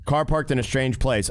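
usual speaking voice

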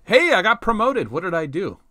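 high, loud delighted surprise; surprised. Real, not acted. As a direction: blurt it out, thrilled. Surprise promotion in game.

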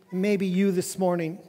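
ordinary talk

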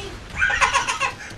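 short, high cackle; gleeful cackle. Real, not acted. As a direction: cackle in delight. Gleeful at kills.

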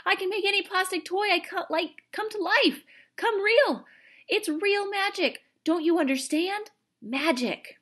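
high, loud breathless-with-joy outburst; exhilarated high-pitched yelling; excited shouting. A narrator's reading. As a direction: shout it out, thrilled by the discovery. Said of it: "he shouted".

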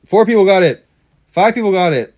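loud, high, thrilled announcement; excited shout. Real, not acted. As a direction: shout it out in delight. Reading results, excited outburst.

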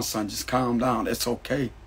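gently calm a son down, slowly and quietly; a mother hushing her upset son, soft and slow; mother's soothing voice. Retold or imitated. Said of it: "I could hear her voice".

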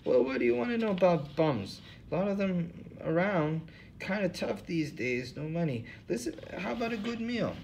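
put-on old hobo, voice pitched well up. Hobo Lou Jenkins to the interviewers, in a high put-on voice. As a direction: play the suspicious bum, pitched high.

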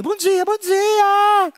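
very high, slow sing-song morning greeting; high drawn-out cartoon spider voice; waking spider. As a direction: stretch it out like a sleepy little spider.

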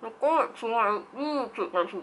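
speaking with teeth covered; tray in mouth. Real, not acted. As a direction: lips over mouth tray. Seen 0: Clear tray fitted over teeth while speaking; removed around +5.

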